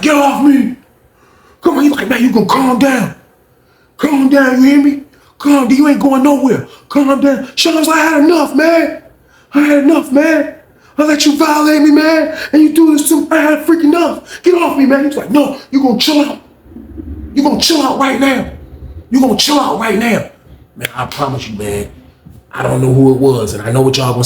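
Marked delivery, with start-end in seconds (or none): 0.0-0.8 high retold shout; acted protesting yell. Pinned Sean protests. 2.1-7.5 high, forceful retold order; acted firm command. Lamont pins and orders Sean. 8.0-15.0 high-pitched angry retold shouting; acted fed-up outburst. Pinned Sean protests. 15.3-20.3 raised-pitch firm refusal; acted insistent order. Lamont insists while pinning.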